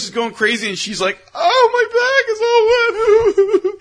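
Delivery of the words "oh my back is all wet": high slow whiny woman's voice, laughing through it; mock her whine. Imitating the beer-soaked woman complaining, high slow whine.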